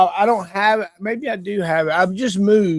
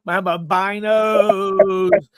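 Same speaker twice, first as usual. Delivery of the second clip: normal talking voice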